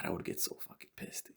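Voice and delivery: hushed repeat of the same gripe; whispered. Retold or imitated. Repeating his homework anger in a whisper. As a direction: whisper it under your breath.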